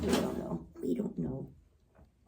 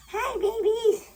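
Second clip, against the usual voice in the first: high, loud, drawn-out coo; cooing pet talk; 9 semitones higher, 5 dB louder, slower than usual. Real, not acted. Owner greeting the animals, high cooing pet talk. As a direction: sing out an adoring greeting.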